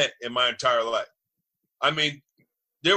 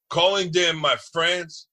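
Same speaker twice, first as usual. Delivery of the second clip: ordinary talk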